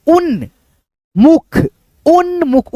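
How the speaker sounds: उन्मुख in two beats, उन्-मुख; joined n-m. Shown as a demonstration. Teacher showing the right reading, उन्मुख with no vowel after न. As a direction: close the न straight into मुख in उन्मुख. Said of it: "उन्मुख पढ़ेंगे ऐसे".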